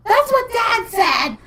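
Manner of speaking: high, slow mock-kid voice; imitated daughter. Daughter dodges mom's question about feelings, high put-on voice. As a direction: mimic a child in a drawn-out high pitch.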